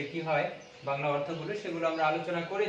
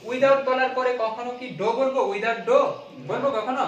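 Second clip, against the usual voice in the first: emphatic quiz question; 5 semitones higher, 6 dB louder than usual. Real, not acted. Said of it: "teacher quizzing class loudly".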